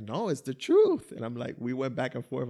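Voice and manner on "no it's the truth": higher, louder, slow insistence; mock kid swearing she's honest; insistent Tati. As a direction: plead it like a kid swearing it's true. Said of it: "And she was like".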